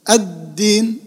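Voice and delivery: الدين as ad-deen, held on the D; doubled dal. Shown as a demonstration. Teacher asking where the shadda is, stressed D in الدين. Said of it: "وين الحرف المشدد".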